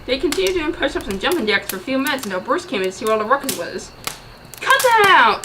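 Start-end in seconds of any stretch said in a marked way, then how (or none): 4.6-5.4 loud high scolding; loud annoyed telling off; angry shout. Acted. Bruce scolds noisy friends.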